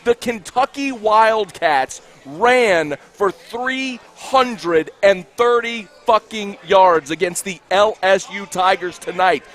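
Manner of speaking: very slow, each word separately punched; slow, emphatic repeat. Real, not acted. Angry host repeating the rushing total so everybody hears, slow emphatic delivery. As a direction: spell it out slowly for everybody, exasperated. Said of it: "say that more slowly so everybody can hear me".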